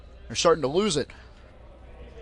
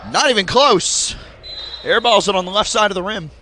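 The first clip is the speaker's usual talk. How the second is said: brisk live sports call; play-by-play commentary; 3 semitones higher, 4 dB louder than usual. Acted. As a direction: call the game as it happens.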